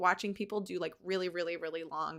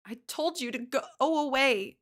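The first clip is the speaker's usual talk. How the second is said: higher, slower, through hiccuping sobs; teary sister pushing him away, sobbing; 6 semitones higher, 5 dB louder than usual. A narrator's reading. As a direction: choke it out between sobs. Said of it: "she hiccups between sobs".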